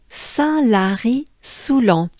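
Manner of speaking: "Saint-Lary-Soulan" slow, with nasal "Saint" and nasal "-lan"; carefully spelled-out French "Saint-Lary-Soulan"; French place name. Shown as a demonstration. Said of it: "the sounds we just worked on".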